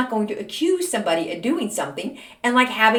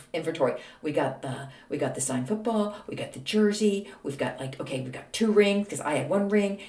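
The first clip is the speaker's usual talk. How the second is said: ordinary talk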